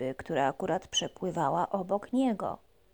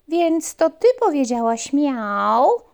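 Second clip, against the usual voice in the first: louder, slower puppy question; 7 semitones higher, 8 dB louder, slower than usual. Acted. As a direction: ask the mouse eagerly. Puppy asks mouse.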